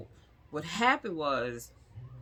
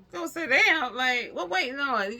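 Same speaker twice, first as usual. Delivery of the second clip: incredulous echo; 9 semitones higher than usual. Retold or imitated. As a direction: throw "Go sit down?" back in disbelief. Echoing the nurse in disbelief.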